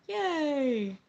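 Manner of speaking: high, loud yay; drawn-out delighted whoop; happy cheer. Real, not acted. Cheers reply from chat.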